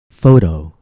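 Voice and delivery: "Photo" with the T as a light, quick D; tapped T. Shown as a demonstration. Teacher demonstrating the tap to learners, tapped T in "Photo". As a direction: flap the T in "Photo". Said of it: "this is known as the tap".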